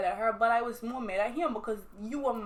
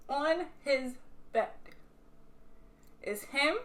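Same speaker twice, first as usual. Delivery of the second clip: drawn-out "on his bed"; dramatic repeat; 3 semitones higher, slower than usual. Real, not acted. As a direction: stretch "on his bed" slowly for suspense.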